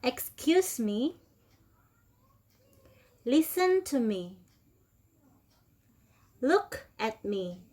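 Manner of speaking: slow, clear drill phrases for students to repeat; teacher model reading. Shown as a demonstration. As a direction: model each phrase slowly for the class to copy. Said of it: "Oke, one more time dari atas".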